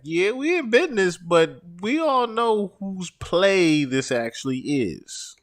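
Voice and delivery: high-pitched sarcastic imitation. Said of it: "Mace had to be sarcastic and say".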